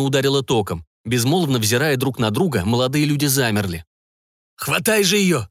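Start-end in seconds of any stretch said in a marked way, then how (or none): 4.6-5.5 higher, gurgling urgent shout. Acted. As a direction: push the words through a gurgling throat. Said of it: "странным булькающим голосом произнёс Лу".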